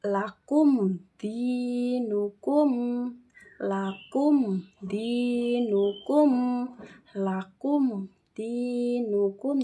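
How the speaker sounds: slow, measured "lakum dinukum", every m clear with no nasal hum; clear, unhummed m. Shown as a demonstration. Teacher models "lakum dinukum" for children to repeat, clear and slow. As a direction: recite "lakum dinukum" slowly and distinctly, no blurring of the m. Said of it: "tanpa mendengung, tanpa bergetar".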